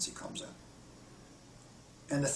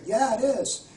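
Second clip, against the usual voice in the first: put-on child's voice; 9 semitones higher, 7 dB louder, slower than usual. Child voice answers in story.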